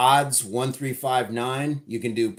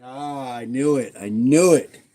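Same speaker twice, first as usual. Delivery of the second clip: dramatic; 8 dB louder than usual. Real, not acted. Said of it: "so dramatic with the away".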